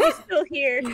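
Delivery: a loud laugh, then the words; said through real laughter. Real, not acted. Laughing at the mix-up.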